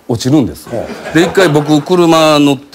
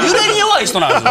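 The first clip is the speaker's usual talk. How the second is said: usual speaking voice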